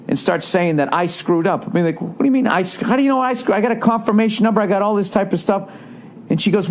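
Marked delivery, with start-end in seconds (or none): 2.0-5.7 high-pitched, tumbling objection; retold arguing, tripping over the words in indignation; indignant protest. Traveler to agent who blames him, indignant.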